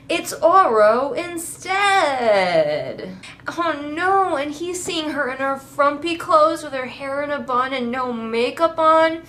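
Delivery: high, drawn-out fake dismay; mock horror. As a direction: ham up the rom-com catastrophe, stretching the words. Mocking the rom-com mishap as catastrophe.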